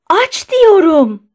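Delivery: high, slow, loud command; trembling shout. A narrator's reading. Shaking doctor ordering the guard to open, desperate shout. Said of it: "diye bağırdı".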